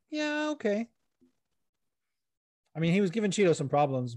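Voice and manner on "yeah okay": sarcastic disbelief. Retold or imitated. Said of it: "And I'm like".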